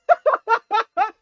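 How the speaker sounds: loud, high burst of laughter. Real, not acted. Streamer laughs at Kobe delivering pizza, loud laugh. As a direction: crack up at the news.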